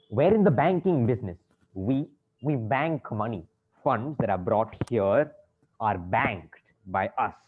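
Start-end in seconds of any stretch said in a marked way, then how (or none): 1.7-7.3 slow, low, deliberate explanation; laboured, lower-pitched lecturing; patient explaining. Acted. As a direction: spell it out slowly, word by word. Banker explains as if to a child.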